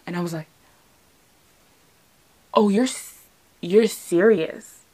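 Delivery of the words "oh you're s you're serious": halting, drawn-out and falling on "serious"; dawning realization. Retold or imitated. Girlfriend taking in her boyfriend's yes, slow stunned realization. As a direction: let "serious" sink in slowly, no question. Sinking in after he says yeah.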